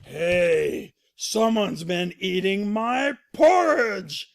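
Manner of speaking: loud, slow, indignant father bear; Papa Bear angry. Acted. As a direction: boom it out slowly, stretching the grievance. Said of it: "and he goes".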